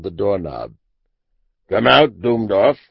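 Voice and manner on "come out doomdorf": loud, commanding bellow; big bellowing call. A narrator's reading. Randolph calling to Doomdorf through a locked door, bellowing. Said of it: "in his big, bellowing voice".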